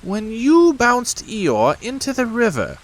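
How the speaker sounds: high, self-pleased rabbit voice. A narrator's reading.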